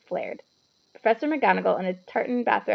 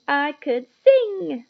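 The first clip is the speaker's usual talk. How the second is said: gleeful; 5 semitones higher, 7 dB louder, much slower than usual. A narrator's reading. Hermione gleeful after jig.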